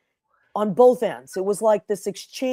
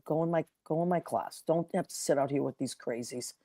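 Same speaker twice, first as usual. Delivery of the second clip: hushed aside; 5 semitones lower, 12 dB quieter than usual. Retold or imitated. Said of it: "I'd say".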